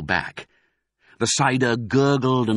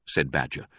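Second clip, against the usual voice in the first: ordinary delivery, nothing marked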